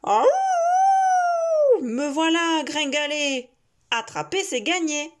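loud wolf shout; howling wolf. Acted. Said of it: "Le loup hurle".

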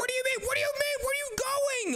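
very high frantic squeal; alarmed protest. Real, not acted. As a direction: protest in a panic as your brother runs off. Brother runs off toward danger.

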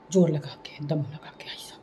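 whispered heave-ho, fading to breath; hushed mock heave-ho; mock straining whisper. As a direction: breathe the effort words out under the voice. Shows straining effort.

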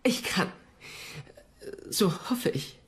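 hushed, breathy half-voiced reply; whisper. Whispering a worried answer to the lord. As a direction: barely voice the promise.

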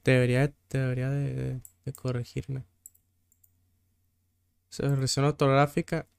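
low, soft, halting self-talk; muttering to self. Real, not acted. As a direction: think aloud quietly while checking. Mumbles while checking the spelling. (murmurando para sí, bajito)

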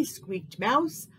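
ordinary delivery, nothing marked

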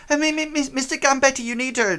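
very high, stammering orc secretary; flustered secretary. Acted. Orc secretary trying to stop the boss, high stammer. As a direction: squeak out the stammer. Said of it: "she sort of says".

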